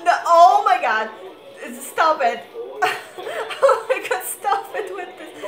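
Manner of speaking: rising, laughing exclamations; giddy overwhelm. Real, not acted. Fan begging the video to stop, giddy overwhelm.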